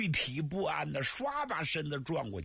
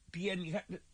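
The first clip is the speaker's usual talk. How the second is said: ordinary delivery, nothing marked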